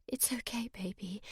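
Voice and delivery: soft whisper to herself. A narrator's reading. Sammy soothing her baby, whispering. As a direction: breathe it to calm herself. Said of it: "she whispered".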